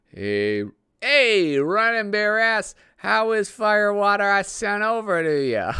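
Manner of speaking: loud, high hollering character voice; big high-pitched shouted greeting across a distance; not the expected accent. Acted. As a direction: holler it out in a goofy put-on voice, drawn out. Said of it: "I'll read it in not the accent".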